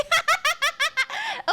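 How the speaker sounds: high, giggly burst of laughter; high cackling giggle fit; real laughter. Real, not acted. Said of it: "I'm laughing about".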